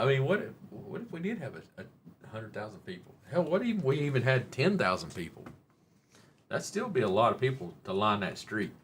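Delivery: usual speaking voice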